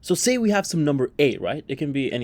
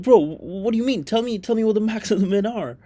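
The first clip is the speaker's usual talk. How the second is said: exasperated demand; 6 semitones higher than usual. Retold or imitated. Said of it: "You're like".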